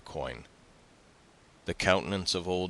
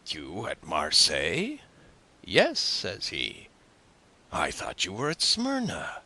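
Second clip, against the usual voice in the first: hearty tailor neighbour; 4 dB louder than usual. Acted. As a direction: play a chummy gossip recounting a meeting. Story narrator voices the tailor neighbour.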